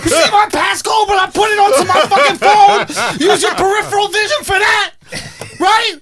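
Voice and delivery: high-pitched, shouted comic rant; furious outburst. Acted. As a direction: blow up at her, yelling way up high. Acted rant at his girlfriend.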